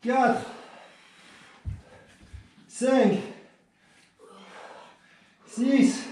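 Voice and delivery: counting reps mid push up; doing push ups. Real, not acted. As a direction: count out while straining through reps. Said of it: "ce ne sont pas des vraies pompes".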